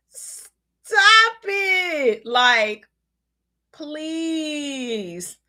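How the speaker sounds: high overwhelmed stop it; gushing. Retold or imitated. Viewer gushing at the announcers' kindness, high squeal. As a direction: squeal like it is too much.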